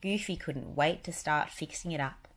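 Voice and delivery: usual speaking voice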